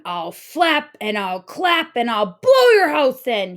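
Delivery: loud squawking bird voice; squawking eagle. A narrator's reading. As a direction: squawk the threat like a greedy eagle. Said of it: "he squawked".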